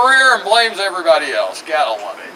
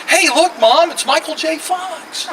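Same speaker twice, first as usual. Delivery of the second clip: put-on little girl voice; 8 semitones higher than usual. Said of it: "some little girl's going".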